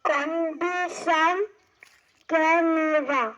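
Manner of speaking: loud recitation. Acted. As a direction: declaim it to the whole class. Child reciting trisyllable examples.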